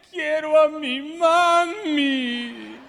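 put-on slow, childlike "Quiero a mi mami"; sad child's plea. Preacher voicing a sad person wanting mom, slow childlike plea. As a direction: plead slowly like a sad little child. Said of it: "uno dice".

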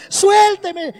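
high mock tantrum yell; berrinche de niña. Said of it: "empezó a hacer un berrinche". (grito agudo de berrinche infantil imitado)

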